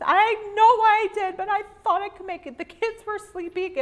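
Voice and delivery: high, strained pleading to the husband; pleading near tears. Retold or imitated. Wife excusing herself to her husband, tearful pleading. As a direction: plead your case almost crying. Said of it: "I was almost in tears".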